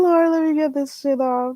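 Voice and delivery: ordinary talk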